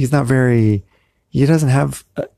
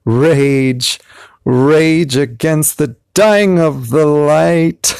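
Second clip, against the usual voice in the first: higher, drawn-out declaimed yell; yelled poem climax; 5 semitones higher, 4 dB louder, much slower than usual. Acted. Poet's final plea as he likes to yell it, yelled declamation. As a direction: cry out the last line, stretching it long. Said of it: "I like to yell it".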